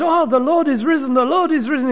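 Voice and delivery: high, bright joyful announcement; excited news. Acted. Disciples telling Thomas, pitched high with excitement. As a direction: burst out with the good news.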